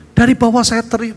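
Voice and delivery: ordinary delivery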